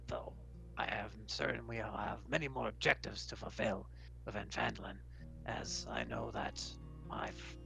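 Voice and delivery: measured, formal robot-like voice; a solemn machine stating its purpose; metallic construct, earnest. Acted. Sabrak tells of a departed friend's wish, earnest metallic voice.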